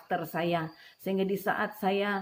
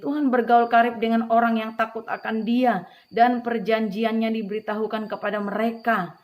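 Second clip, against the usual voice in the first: proclaimed scripture; 4 semitones higher, 9 dB louder than usual. Acted. Opening scripture verse, proclaimed.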